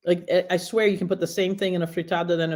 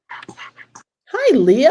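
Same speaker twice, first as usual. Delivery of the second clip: excited greeting; 8 semitones higher, 9 dB louder than usual. Real, not acted. Greeting son joining the stream.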